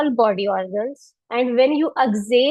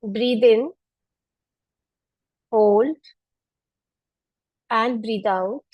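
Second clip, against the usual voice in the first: calm, spaced breath cues; guided breathing cues; slower than usual. Acted. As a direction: let each cue hang for the breath. Counts breaths for class. (शांत श्वास संकेत)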